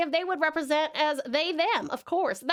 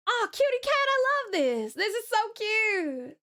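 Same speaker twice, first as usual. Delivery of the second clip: Southern Belle gushing; 8 semitones higher than usual. Acted. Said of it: "Southern Belle".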